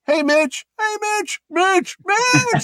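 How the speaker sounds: high, insistent calls for attention; calling out. Retold or imitated. Fans calling to Mitch past the celebrity, high insistent calling.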